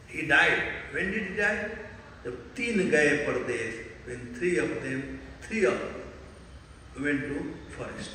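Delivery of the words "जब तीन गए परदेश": riddle recitation. Shown as a demonstration. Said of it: "I'll translate in Hindi".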